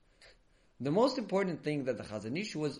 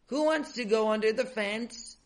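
high-pitched call to the group; announcing; 7 semitones higher, 3 dB louder than usual. A narrator's reading. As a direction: announce it as a raised-pitch challenge. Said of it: "they announced".

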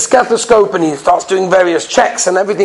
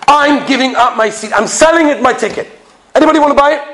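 raised voice offering his ticket to the plane; calling out to sell; 7 semitones higher than usual. Acted. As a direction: call out to the whole cabin, a little fed up. Impatient passenger selling his seat.

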